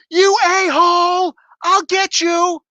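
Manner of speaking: high, drawn-out angry yell; mock outrage. Car owner yells at fleeing hit-and-run driver, mock angry. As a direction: yell after him in hammed-up fury.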